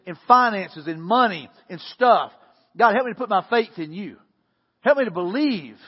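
loud, rising, pleading prayer voice; fervent prayer. Acted. Pastor leading the congregation in prayer, loud and pleading. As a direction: cry out to God in earnest, building in force.

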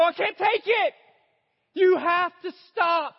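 loud, high, breaking shout; exhausted father yelling at his screaming toddler; desperate yell. Retold or imitated. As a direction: yell it, at the end of your rope. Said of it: "and I go".

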